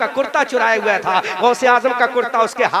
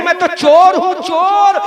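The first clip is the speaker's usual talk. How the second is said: thief's loud confession; 7 semitones higher, 5 dB louder than usual. Acted.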